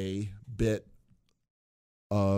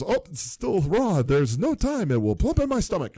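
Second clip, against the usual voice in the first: hurried Apu; 10 semitones higher, 5 dB louder than usual. Retold or imitated. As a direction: voice Apu deciding to gulp it down. Apu rushing at the party.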